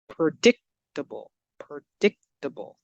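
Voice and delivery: predictable said slowly, DIC punched; careful, slow demo of pre-DIC-table; stressed syllable demo. Shown as a demonstration. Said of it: "let's look at where we stress the word".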